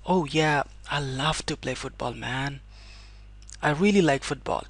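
casual habit talk. Shown as a demonstration. Teacher acting a friend describing a habit, relaxed and casual. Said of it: "but in the sense of telling your habit".

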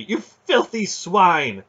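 high, loud, slow outraged cry; helpless rage. A narrator's reading. Aunt raging helplessly at the man, furious. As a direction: drag out the insult in helpless fury. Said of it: "Aunt Paul raged helplessly".

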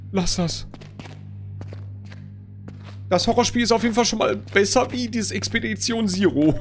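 ordinary talk

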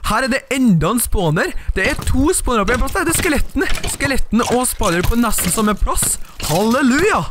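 high, excited gamer voice; thrilled. Real, not acted. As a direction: shout it out, overjoyed at the find. Thrilled at finding spawners.

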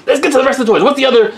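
ordinary delivery

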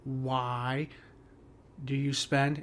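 WHY drawn out and set apart before "do you spend"; stress on WHY. Shown as a demonstration. Teacher testing a stressed opening word, stress on WHY. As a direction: lean on WHY, then pause. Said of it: "put the stress on why".

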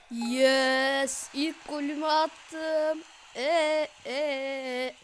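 loud, slow triumphant cheer; excited cheering. Real, not acted. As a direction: celebrate the goal out loud. Celebrating first goal.

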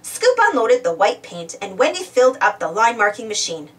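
plain reading voice

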